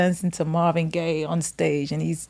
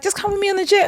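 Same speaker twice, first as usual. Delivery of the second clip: casual invite; 10 semitones higher, much faster than usual. Retold or imitated. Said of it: "he's just like".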